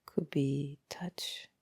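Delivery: normal talking voice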